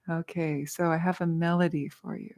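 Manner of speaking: ordinary talk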